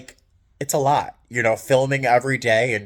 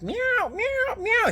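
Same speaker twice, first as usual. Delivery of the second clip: high whiny cat meow copied; 3 dB quieter, much slower than usual. Retold or imitated. Speaker mimicking her cat begging to go out, meowing.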